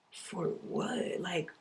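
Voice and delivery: hushed. Real, not acted. Self-talk, dropping to a hush.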